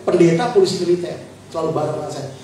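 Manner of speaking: ordinary talk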